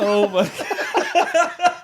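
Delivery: slow, laughing oh my God; laughing slowly at the radio story. Real, not acted. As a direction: drawl it out through laughter.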